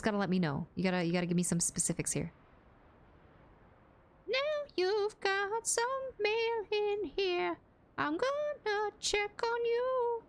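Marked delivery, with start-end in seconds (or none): 4.3-10.2 high, slow, sing-song voice; high drawn-out mock-sweet notification voice; silly voice. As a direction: coo it like a creepy cartoon. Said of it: "how do you want me to say it".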